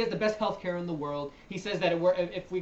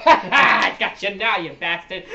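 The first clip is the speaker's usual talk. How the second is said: loud, drawn-out crowing through laughter; gleeful laughing outburst; 11 dB louder, slower than usual. Real, not acted. Host gloating as the video starts, loud and laughing. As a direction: crow it loudly, breaking into laughter.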